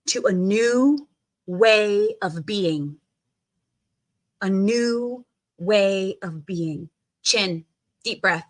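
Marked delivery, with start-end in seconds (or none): 0.1-6.9 slow. Shown as a demonstration. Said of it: "slow affirmation".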